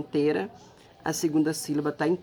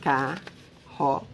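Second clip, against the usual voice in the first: "Carroça" as ca, rro, ça, widely spaced; syllable by syllable; much slower than usual. Shown as a demonstration. Teacher answering how many syllables, "Carroça" in slow beats. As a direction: pause after each syllable of "Carroça". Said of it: "ela tem quantas sílabas?".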